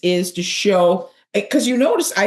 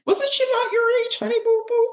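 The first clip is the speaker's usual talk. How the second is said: silly high voice. Pretend. Asking her co-host's age, high whiny squeak. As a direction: ask it in a squeaky mock-baby whine. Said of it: "Now we're just getting overly silly".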